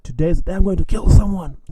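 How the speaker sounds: imitated drunken gunman's threat; acted threat. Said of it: "you know, said".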